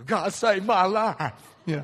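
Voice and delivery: slow, loud and emphatic, every word weighted; fervent declaration. Retold or imitated. Recovering alcoholic answering the woman's question about God, slow and loud. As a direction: declare it like a testimony.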